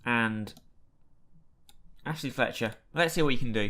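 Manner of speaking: ordinary talk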